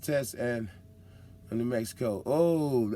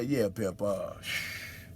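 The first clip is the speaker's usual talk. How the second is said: mock road sign voice. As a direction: play the warning sign like a smooth hustler. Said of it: "they hit you with a sign and say".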